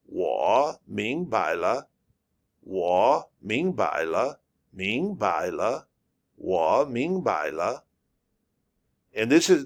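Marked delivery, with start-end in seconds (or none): 0.1-7.9 我明白了 said slowly with each tone drawn out; slow Mandarin demo. Shown as a demonstration. Teacher modeling the full sentence slowly for learners. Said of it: "Wa, ming, bai, la".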